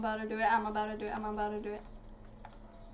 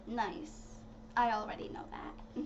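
unimpressed whisper, breathed under the voice; deadpan whisper; 5 semitones lower, 9 dB quieter than usual. Retold or imitated. Answering the creepy doll, in a flat unimpressed whisper. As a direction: brush her off in a hushed, bored whisper. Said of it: "I'll be like".

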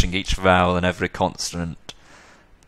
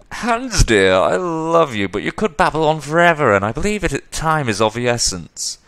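teasing, impatient figure; 8 semitones higher, 3 dB louder than usual. Acted. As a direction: cut off a long-winded friend in a high, loud lilt.

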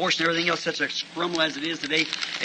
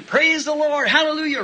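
higher, ringing praise shout; retold praise shout; 10 semitones higher than usual. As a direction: shout it out like joy in the woods. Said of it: "I was screaming".

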